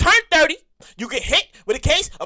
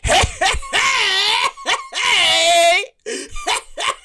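high, excited party calls; hyped party calls; 5 semitones higher, much slower than usual. Retold or imitated. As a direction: call out like you're partying. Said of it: "In my twenties, I was like".

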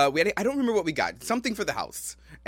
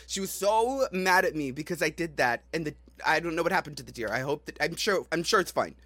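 ordinary delivery

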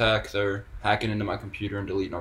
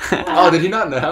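laughing; 6 semitones higher, 9 dB louder than usual. Real, not acted. Caller surprised on air.

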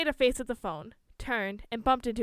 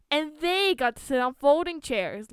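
raised, louder grumble of resentment; indignant complaint; 5 semitones higher, 5 dB louder than usual. A narrator's reading. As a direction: complain about the unfairness, pitched up. Indignant thought in the story.

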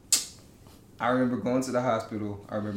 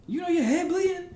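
put-on girl's voice. Girl voiced in retold story.

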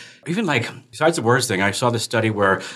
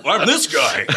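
tough guy voice; 5 semitones higher, slower than usual. Pretend. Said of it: "I get all tough".

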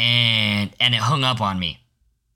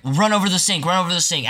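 high, hurried teacher's order; urgent teacher; 7 semitones higher than usual. Retold or imitated. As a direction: snap out the order like a panicked sub. Substitute teacher urging choking kid.

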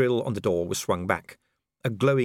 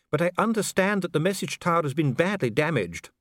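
higher, smooth and coolly polite; a bureaucrat soothing a prisoner; calm official Dee; 9 semitones higher, 4 dB louder than usual. A narrator's reading. Dee answering imprisoned Vimes, coolly polite.

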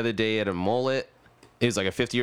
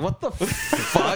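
drawn-out, higher-pitched incredulous reaction; retold disbelief; 7 semitones higher, much slower than usual. Turning round on an unvaccinated customer, incredulous. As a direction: stretch it out slowly in mock shock.

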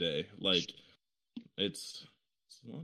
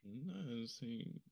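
very quiet; 16 dB quieter than usual. Real, not acted. Friend mumbling a reply under his breath, very quiet. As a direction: say it far softer, almost lost. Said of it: "That was so quiet".

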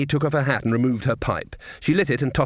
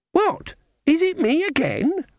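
put-on squeaky, much higher old witch voice, gloating; gloating old crone; slower than usual. Old witch to card opponent, high smug crone voice. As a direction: crow it like a smug old hag winning again.

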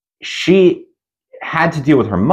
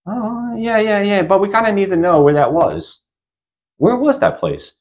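much higher, dismissive and pressing; pushy doctor; 8 semitones higher than usual. Retold or imitated. Doctor brushing off Carly's trauma, much higher.

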